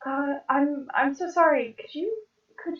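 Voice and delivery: high-pitched, youthful apology; too-young Japsor. Acted. Hard-of-hearing Japsor apologising to Tara, too-young character voice. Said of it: "this is too young".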